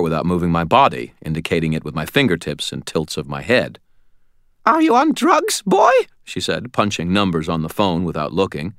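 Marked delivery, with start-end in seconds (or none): 4.7-5.9 high, squeaky, scolding demand; querulous old lady. A narrator's reading. Old lady to intruder while dialling, squeaky scolding. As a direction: berate him in a squeaky old-woman voice.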